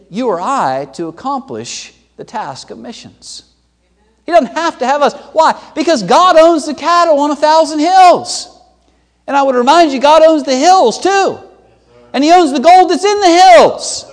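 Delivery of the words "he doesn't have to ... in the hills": high, ringing full-force sermon; impassioned preaching. Acted. Preacher proclaiming what God owns, high and forceful. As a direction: build louder and higher to each line's end.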